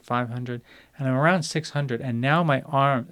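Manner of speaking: normal talking voice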